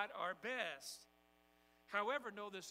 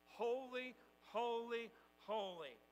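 congregation call; 3 semitones higher, 4 dB louder, slower than usual. Shown as a demonstration. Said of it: "Say that with me".

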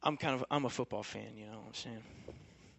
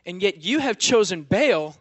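rising, louder preaching of God's rebuke; 4 semitones higher, 8 dB louder than usual. Acted. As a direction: thunder it out like God scolding his people.

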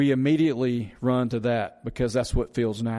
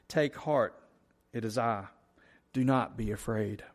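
quiet scripture reading; 3 semitones lower, 8 dB quieter than usual. Shown as a demonstration. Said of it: "I'm gonna read verse fifty again".

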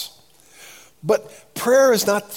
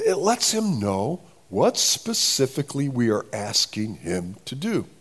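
normal talking voice

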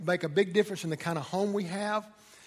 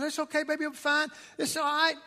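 mock soothing husband; 9 semitones higher than usual.

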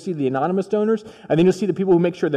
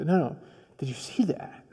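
soft insistent Jesus; 9 dB quieter than usual. Acted. Jesus voiced, insisting softly.

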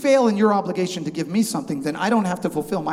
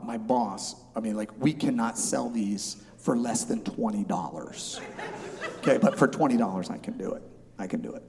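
lower, softer, hushed seller's aside; confidential hush; 7 semitones lower, 6 dB quieter than usual. Retold or imitated. Market seller pulling the buyer aside, mock confidential hush. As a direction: draw him close and share a secret. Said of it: "now we're friendly".

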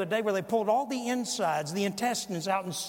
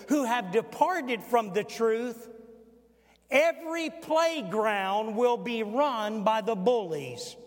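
loud, raised pulpit delivery with slow, weighty phrases; emphatic preaching; 4 semitones higher, 4 dB louder, slower than usual. Acted. Preacher quoting a warning to young parents, emphatic preaching. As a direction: hammer the quotation home, loud and high.

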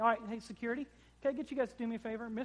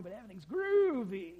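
California dude; 4 dB louder than usual. Retold or imitated. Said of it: "the dude in California".

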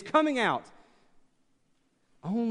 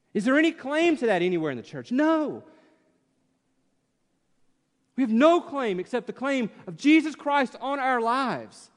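raised, insistent pulpit voice; fervent preaching; 4 semitones higher than usual. Acted. Preacher building force to his congregation, raised and insistent. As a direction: press the point home to the church.

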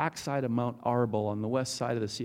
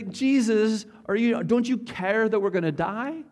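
higher, louder frightened cry to Jesus; panicked plea; 8 semitones higher, 4 dB louder than usual. Acted. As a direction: beg like the boat is sinking. Disciples panicking in storm.

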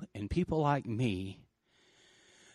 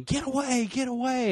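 high mock yell of a warning crowd; retold yelling; 11 semitones higher than usual. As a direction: throw it up high like bystanders warning. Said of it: "people are yelling".

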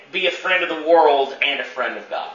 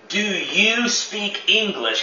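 slow, higher, careful question "Do you speak English?"; asking someone; 5 semitones higher, slower than usual. Shown as a demonstration. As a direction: pastor shows how he'd ask someone, slow and pitched up. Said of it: "if I wanted to ask someone".

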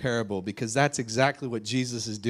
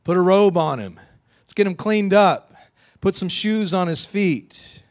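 loud, raised eager orders; urgent father; 8 semitones higher, 8 dB louder than usual. Acted. Father ordering a welcome for his returned son, urgent. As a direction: call out orders for a homecoming. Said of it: "Next thing the father does is says".

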